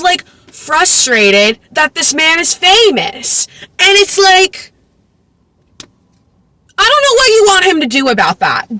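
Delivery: incredulous. Real, not acted. Incredulous mock-exasperated commentary.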